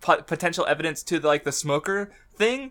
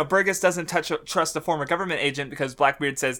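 ordinary delivery

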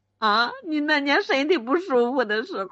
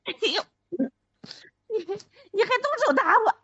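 high, fast tearful wailing; crying wail; 7 semitones higher, much faster than usual. Acted. Wife wailing at her husband for hitting her, tearful and high. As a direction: wail it out as if struck and hurt.

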